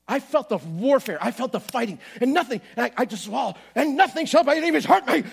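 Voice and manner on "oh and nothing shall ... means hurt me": shout. Retold or imitated. Shouting scripture at pain.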